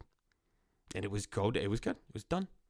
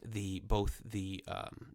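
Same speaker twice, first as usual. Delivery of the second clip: ordinary delivery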